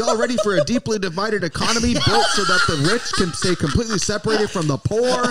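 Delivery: high, laughing, preachy host voice; mock-rallying American emcee. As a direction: hype the crowd like a southern preacher host. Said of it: "I'd be like".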